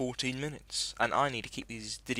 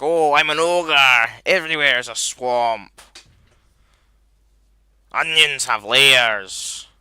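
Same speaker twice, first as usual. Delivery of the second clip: loud, slow Scottish ogre voice, a bit higher; Shrek voice; 3 semitones higher, 16 dB louder, slower than usual. Pretend. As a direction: boom it out like Shrek lecturing about onions. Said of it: "Shrek's like".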